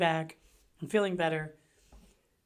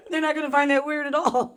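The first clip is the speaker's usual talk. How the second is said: laughing; 7 semitones higher, 4 dB louder than usual. Real, not acted. Laughing at friend's joke.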